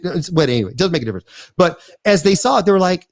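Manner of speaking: ordinary talk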